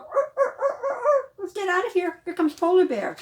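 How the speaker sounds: high squeaky seal barks; scared seals. Pretend. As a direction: yelp like frightened seals. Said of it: "they're probably going".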